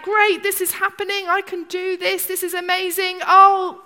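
loud, high, gushing enthusiasm; overexcited inner voice; mock excitement. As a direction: play up the giddy thrill of stepping out. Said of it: "and you're like".

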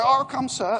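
loud, high eager reply; mock shouted answer. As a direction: shout back like a keen junior. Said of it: "and you go".

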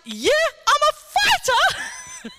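high, laughing mockery of her words; a slow, laughing echo of the woman's boast; incredulous mocking quote. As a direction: repeat it in high, laughing disbelief. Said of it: "You've just been healed by the King of Kings".

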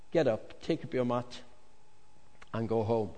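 soft, quiet, kindly instruction; soft tender command; gentle. Acted. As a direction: speak it gently, close to him. Jesus speaks gently to him.

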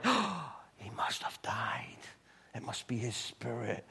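slow, awed, hushed whisper; the church fearing Peter is dead; fearful whisper. Retold or imitated. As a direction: whisper like they've seen a ghost. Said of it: "they're like".

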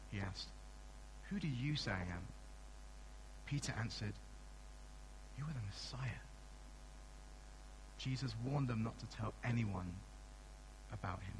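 soft, measured Bible reading; hushed scripture reading to the congregation. Acted. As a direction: read the passage quietly and reverently. Scripture reading before sermon.

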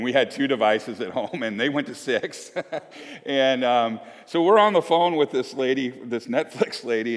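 ordinary delivery

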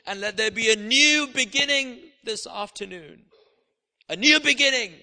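loud, forceful declaration in prayer; fervent prayer. Real, not acted. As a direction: build to a ringing, insistent plea. Prays at full force.

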